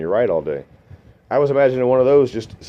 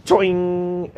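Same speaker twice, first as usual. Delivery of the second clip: spring sound effect; 7 semitones higher than usual. Retold or imitated. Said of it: "like a fucking spring-loaded snake".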